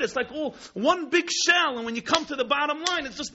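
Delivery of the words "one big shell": anguished. Acted. Despair at the empty ark.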